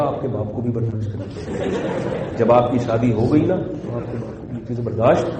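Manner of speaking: hushed, cautious comeback for comic effect; very quiet retort. Retold or imitated. Preacher answers a hot-tempered husband, keeping it very quiet. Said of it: "بہت آہستہ سے بولا".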